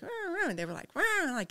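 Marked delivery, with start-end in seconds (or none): 0.0-0.5 high "rah, rah" siren wail; high two-note wail, not forceful; mild siren imitation. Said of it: "they weren't like". 0.9-1.3 loud, high blaring "rah"; forceful high siren blare; urgent siren imitation. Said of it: "Get the hell out of the way".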